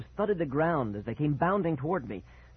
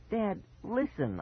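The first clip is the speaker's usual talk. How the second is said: pleading; 9 semitones higher, slower than usual. A narrator's reading. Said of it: "I pleaded".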